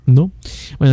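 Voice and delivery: ordinary talk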